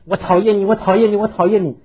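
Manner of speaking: put-on the valley's echo throwing the boy's shout back at him; echoing mock yell. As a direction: call it back like a canyon echo, over and over. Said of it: "各式各样的声音回来说".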